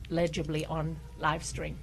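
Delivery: ordinary talk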